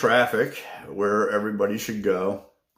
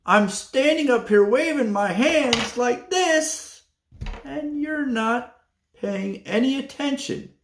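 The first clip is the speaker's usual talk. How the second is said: imitating a scolding receptionist, much higher; annoyed lady; 12 semitones higher, slower than usual. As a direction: complain he ignored you, in her put-on voice. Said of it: "she says to me".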